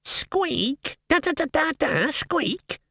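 high mocking squeaky drumroll; mock the drumroll in squeaks. Rat mimics drumroll.

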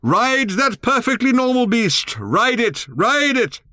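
high, slow yelled urging; old man shouting from a distance; distant shout. A narrator's reading. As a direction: call it from far behind. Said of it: "His distant voice reverberated".

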